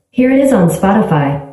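usual speaking voice

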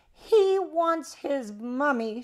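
loud, high, slow holler; shout. A narrator's reading. Old uncle shouting for the baby's mummy, loud drawn-out call. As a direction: shout it out with long words. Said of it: "shouted the old uncle".

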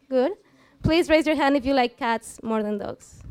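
ordinary delivery

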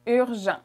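urgent in French, urzhon with a throaty R and nasal end; French pronunciation. Shown as a demonstration. Teacher modelling urgent after the English word. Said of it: "Urgent in French is urgent".

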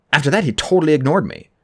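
usual speaking voice

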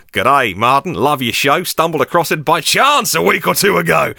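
light put-on Australian accent, reading a listener's email; a hint of Aussie twang on each line; mock Australian accent. Reading out a fan's email in a mock Australian accent. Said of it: "I've got to stop this accent".

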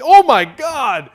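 loud, high, drawn-out "oh my God"; stretched-out exclamation of disgusted shock; horrified outcry. Retold or imitated. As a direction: cry out in mock horror at a gross discovery. Acting out horrified look-up discovery.

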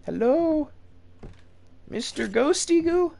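high nervous call into the dark; timid calling out. Real, not acted.

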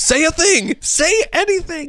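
high, loud demand; exasperated shout. Real, not acted. Urging co-host during the mic check, high exasperated shout.

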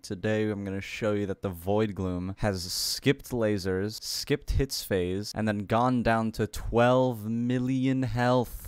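low, slow, subdued narration; editor voiceover. Real, not acted. Editor shows viewers the skipped phases, subdued voiceover. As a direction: narrate it slowly and quietly.

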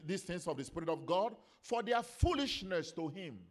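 loud, high, emphatic pulpit delivery; full-force preaching. Real, not acted. Preacher teaching the church about the Holy Ghost, full-force preaching. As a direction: drive each phrase home to the congregation.

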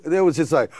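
normal talking voice

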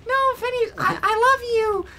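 put-on falsetto lovesick girl; high squeaky confession. Girl confessing love to Phineas, squeaky.

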